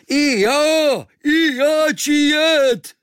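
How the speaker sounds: very high, loud and slow cry; Kvíkvék shouts to join in; eager shout. Acted. Kvíkvék shouts to the sailors to take him too, very high and loud.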